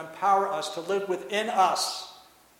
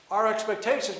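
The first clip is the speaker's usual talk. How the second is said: normal talking voice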